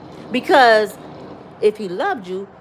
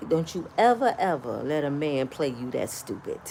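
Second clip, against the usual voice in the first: low, soft, deliberate aside; hushed warning; 9 semitones lower, 8 dB quieter than usual. Retold or imitated. Brother advising his sibling after the call, low and hushed. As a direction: confide a stern lesson quietly.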